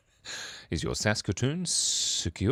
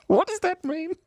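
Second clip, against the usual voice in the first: squeaky high voice; 3 dB louder than usual. Pretend.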